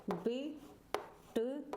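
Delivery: विट्ठल drawn out slowly, बिट cut short; विट्ठल as biʈ-ʈʰəl, first part short; slow demo word. Shown as a demonstration. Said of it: "बिट बहुत short में बोला".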